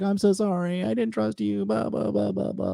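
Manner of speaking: high put-on whiny apology voice; mocking girlfriend. Girlfriend apologising to him, high mocking voice. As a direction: mimic her sorry in a squeaky voice.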